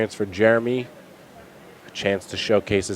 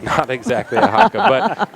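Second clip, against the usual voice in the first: laughing high-pitched repeat, much higher and louder; 11 semitones higher, 5 dB louder than usual. Real, not acted. Repeats line laughing, pitch jumps.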